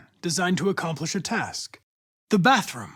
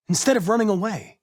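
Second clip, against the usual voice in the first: normal talking voice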